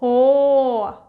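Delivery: ordinary talk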